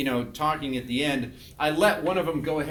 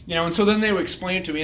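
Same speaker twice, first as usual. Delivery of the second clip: usual speaking voice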